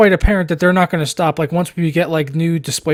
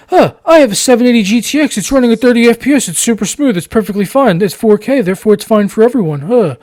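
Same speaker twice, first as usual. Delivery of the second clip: put-on high, loud whiny kid; 5 semitones higher, 7 dB louder than usual. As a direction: whine it like a forum brat. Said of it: "sounds like a whiny kid on a Steam".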